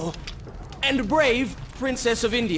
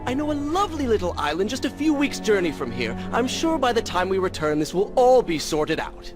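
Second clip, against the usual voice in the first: high, singsong sarcasm; exaggerated, higher-pitched mock surrender; 9 semitones higher than usual. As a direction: feign agreement to needle your companion. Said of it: "If it comforts you to mock me".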